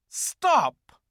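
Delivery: high, loud witch voice; the witch halts her followers; witch command. A narrator's reading. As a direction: snap the order as the witch. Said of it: "said the witch".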